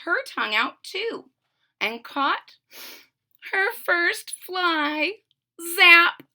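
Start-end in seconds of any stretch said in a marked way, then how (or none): 5.8-6.2 zapping sound. Acted. Sound effect of catching fly.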